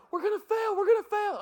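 high mock panic; panicked teammates. Teammates panicking about the salty muffins, high mock fear. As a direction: squeal it like scared kids.

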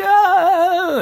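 very high squealed "Ah"; mock lady scream. Imitating the ladies shrieking each time the train rocks, very high. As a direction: shriek like a startled old lady.

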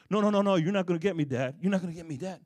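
fast, loud, teasing refusal to his father; a son dodging his dad, quick and loud; Isaac playfully refusing to be caught by his father, fast and loud; defiant young Isaac. Retold or imitated. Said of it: "Isaac looking at Abraham".

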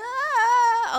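high, loud wail heard through the car; cry outside. Retold or imitated. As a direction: cry out in relief. Crew heard crying out at dawn.

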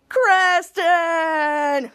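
long shouted name; drawn-out cry. Acted. Rivals fighting over Creston, long dramatic cry of the name. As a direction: call it out long, pleading for rescue.

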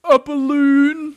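high, loud, slowly drawn-out question; astonished Eeyore. A narrator's reading. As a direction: stretch it out in amazement. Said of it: "said Eeyore".

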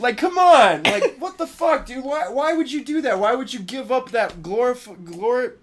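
high-pitched exasperated protest; losing it. Retold or imitated. Fan scolding the movie hero over sold toys, exasperated protest. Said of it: "I just fucking lost it".

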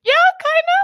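mock teacher reply. Retelling Miss Heaven's verdict, mock teacher reply. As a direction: imitate a teacher half-accepting a bad answer.

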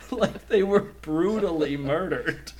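usual speaking voice

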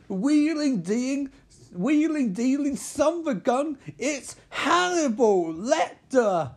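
excited high singsong intro; hyped-up reveal. Acted. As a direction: sell it like a game show host unveiling the star.

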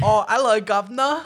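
high, loud hammed-up British caller; mock British accent. Host fakes a British actor on the phone, mock British accent. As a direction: put on a cheeky British accent, badly. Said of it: "we don't do accents on the show".

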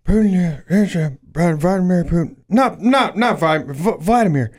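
high, strained mock old-man voice, stumbling over names; hammed-up sputtering old man, building to a high outburst; imitated angry old man. Co-host mocks the president denouncing Putin, as a sputtering angry old man. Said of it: "He probably said it like this".

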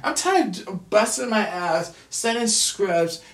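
ordinary talk

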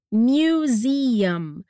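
"museum" said very slowly, lips closing on the final M; careful slow pronunciation. Shown as a demonstration. Teacher points out how to say "museum", very slow. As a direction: stretch out "museum", shut the lips at the end. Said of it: "atenção pra pronúncia da palavra museu".

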